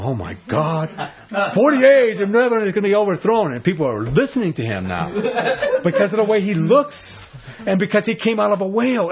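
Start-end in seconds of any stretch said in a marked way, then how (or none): 0.0-3.5 alarmed townspeople. Retold or imitated. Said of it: "people are going". 5.1-9.1 laughing. Real, not acted. Laughing while telling the story.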